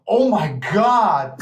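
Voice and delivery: slow, drawn-out mock amazement; imitated awestruck gasp. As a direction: act out a stranger stunned at the sight of him. Acted reaction of admiring woman.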